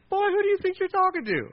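very high, affronted royal outburst; hammed-up high-pitched indignation; indignant king. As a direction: play an offended king putting a boy in his place. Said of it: "if Saul had been like".

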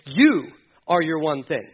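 normal talking voice

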